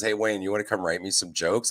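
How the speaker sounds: ordinary talk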